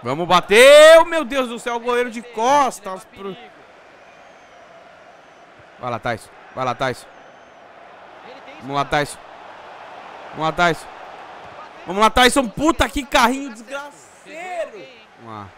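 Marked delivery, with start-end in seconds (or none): 0.0-3.1 excited. Real, not acted. Tense free kick moment. 11.9-14.8 higher, frustrated exclamation; exasperated outburst. Real, not acted. Reacting to hard tackle.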